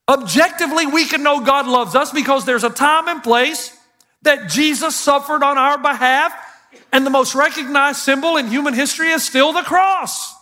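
rising, driving sermon delivery; preaching. Acted. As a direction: preach it out with conviction to the crowd. Preaching sermon at full force.

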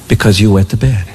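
very low, deadpan monotone; stern parent. Retold or imitated. Parent explains the water ban to child, mock stern. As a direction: lay it down as an unbudging parent.

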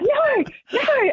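high-pitched mock scream, no real volume; retold scream. Caller reenacting screaming at baby spiders, high mock scream. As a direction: yelp it in panic, reenacting the moment. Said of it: "started screaming out".

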